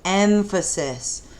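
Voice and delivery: put-on Emphasis said slowly, syllable by syllable, EM-pha-sis for children to repeat; teacher modeling Emphasis slowly for young students; slow, careful word. Shown as a demonstration. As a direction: spell out Emphasis slowly so kids can copy it. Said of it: "Can you say emphasis?".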